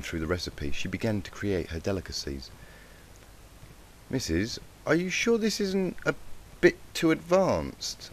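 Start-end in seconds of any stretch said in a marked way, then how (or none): 4.1-8.1 higher, slow colt voice; doubting colt. Acted. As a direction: ask the teacher slowly in a higher colt voice. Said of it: "a brown unicorn colt from across the room asked".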